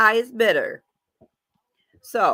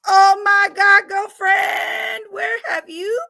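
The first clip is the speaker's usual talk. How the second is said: high squealing fangirl shout; gushing fan; 11 semitones higher, 7 dB louder, slower than usual. Retold or imitated. Fan gushing at Lola, squealing high and loud. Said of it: "they were like".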